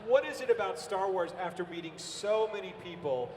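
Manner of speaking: ordinary talk, nothing marked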